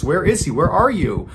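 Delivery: understated panic, searching for someone missing; frantic calling. A narrator's reading. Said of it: "she's frantically yelling for Stephen".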